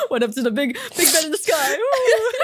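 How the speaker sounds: high cheer, laughing; mock cheer. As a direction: whoop it up laughing. Joking farewell cheer.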